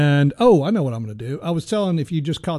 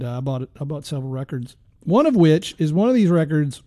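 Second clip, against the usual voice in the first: normal talking voice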